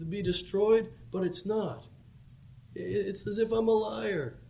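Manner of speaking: high, loud, aggrieved complaint; angry Jonah. Acted. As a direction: rant like a prophet who feels made a fool of. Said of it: "He becomes angry because".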